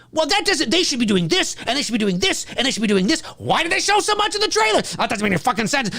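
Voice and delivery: high, fast indignant fan rant; mock ranting fan. Streamer imitating fans ranting about trailers, high and fast. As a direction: whine like an outraged fan.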